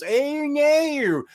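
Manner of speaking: very high, swooping Australian "no"; exaggerated Aussie whine on a long "no"; mock Australian accent. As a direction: stretch the vowels into a cartoon Aussie accent. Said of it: "The word no has, like, eight letters".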